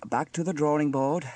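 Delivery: sighing, still fairly loud resignation; resigned sigh. A narrator's reading. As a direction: sigh it out after a setback. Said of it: "sighed Sam".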